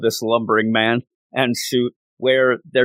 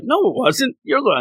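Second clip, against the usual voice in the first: much higher, squeaky defensive whine; a weak underling pleading his case; mock whiny protest; 12 semitones higher than usual. Surgeon denying blame to Wang Chu, squeaky whine.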